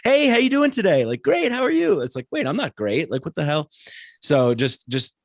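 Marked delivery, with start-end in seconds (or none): 0.0-1.0 very high, louder mock hello; hammed-up high-pitched small talk; fake chirpy greeting. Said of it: "mirror how the other person's saying". 1.2-2.0 very high mock-polite reply; hammed-up falsetto pleasantry; mirrored fake cheer. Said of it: "mirror how the other person's saying". 2.3-3.7 higher-pitched, puzzled self-correction; acted double take; mock bewilderment. Mock realisation after fake reply.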